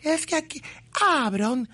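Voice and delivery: high, whiny mocking imitation of the waitress; hammed-up high waitress voice defending the chili. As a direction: squeak her excuse back at him. Mocking the Jalapa waitress's reply.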